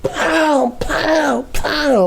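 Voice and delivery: high-pitched "pow" sound effects; playful mimicked blows; imitated punches. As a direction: punch out each hit in a high voice. Imitates blows on his chest.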